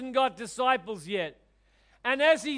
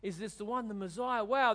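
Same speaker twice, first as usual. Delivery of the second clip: soft, hushed amazement, low and quiet; awed inner voices; 3 semitones lower, 8 dB quieter than usual. Retold or imitated. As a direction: murmur like a stunned crowd thinking aloud. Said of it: "their minds have been going crazy".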